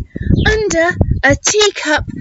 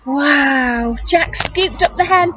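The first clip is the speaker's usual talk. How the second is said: ordinary delivery, nothing marked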